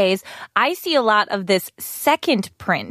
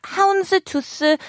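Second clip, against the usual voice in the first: slow, higher "houndstooth" with Korean extra vowels; Korean accent; 9 semitones higher than usual. Shown as a demonstration. Listing the patterns for learners, Korean accent on "houndstooth". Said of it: "pronounce it in a Korean accent".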